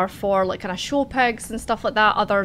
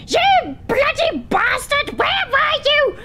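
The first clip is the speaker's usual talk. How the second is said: furious wife; 5 dB louder than usual. Acted. Wife screeching at her husband for coming home late. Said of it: "she's like".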